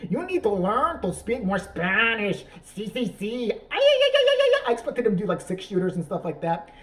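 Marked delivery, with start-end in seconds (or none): 0.0-3.5 higher, hammed-up fake Spanish accent; drawn-out caricature Spanish accent; bad Mexican accent. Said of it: "saying that accent". 3.5-4.7 loud high trilled "ay-ay-ay"; hammed-up mariachi cry in a fake accent; mock Spanish yelp. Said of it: "saying that accent".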